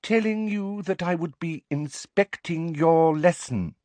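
high-pitched patronizing official; prim, over-articulated explanation; loud slow condescension. A narrator's reading. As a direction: spell it out as if to someone slow. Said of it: "as though she were addressing somebody both foreign".

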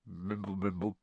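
very soft, indistinct mumbling; whispered mumble. A narrator's reading. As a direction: mumble it faintly under your breath. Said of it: "whispered something that sounded like".

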